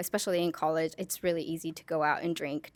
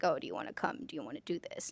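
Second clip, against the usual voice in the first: whispered invitation; 3 semitones lower, 9 dB quieter, faster than usual. Retold or imitated. Friends inviting her out.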